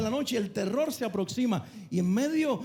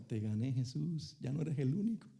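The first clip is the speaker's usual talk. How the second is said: inner thought; 6 semitones lower, 8 dB quieter than usual. Retold or imitated. Peter thinks to himself on the water, low and soft gloating. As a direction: gloat to yourself under your breath. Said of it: "interiormente ... pensando".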